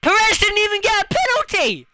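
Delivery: outraged screaming at Perez; furious shout. Real, not acted. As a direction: rage at Perez's penalty. Raging at Perez.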